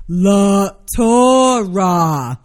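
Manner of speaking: LA TORAH loud, drawn out syllable by syllable; very slow, emphatic LA TORAH; sounding it out. Acted. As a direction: spell out LA TORAH slowly for a slow listener. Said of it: "I sound it out for his simple ass".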